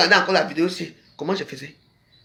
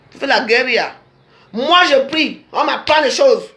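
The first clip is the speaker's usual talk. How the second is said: raised, higher, accusing tirade; heated rant; 6 semitones higher, 4 dB louder than usual. Real, not acted. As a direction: hammer the accusations home, louder. (diatribe enflammée contre l'adversaire)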